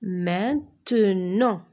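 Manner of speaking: "Maintenant" slow, syllables run closely together; syllables joined slowly. Shown as a demonstration. Teacher turning syllables into a word, syllables joined slowly. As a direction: blend "Maintenant" into one measured word. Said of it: "Je forme des mots avec des syllabes".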